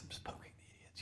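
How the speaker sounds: barely audible whisper. Real, not acted. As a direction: breathe it out almost silent. Repeated whisper, unvoiced.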